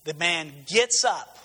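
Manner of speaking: ordinary talk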